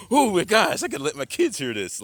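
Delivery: high, loud gushing outburst; acted excitement. Man acting out his amazement at the voice, excited. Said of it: "you get all excited".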